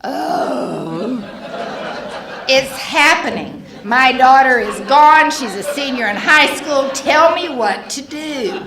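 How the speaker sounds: a frantic mother begging for an answer; desperate plea. Retold or imitated. Desperate mother at Al-Anon meeting.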